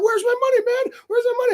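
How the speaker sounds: very high, squeaky mock driver; shrill imitation chasing him down.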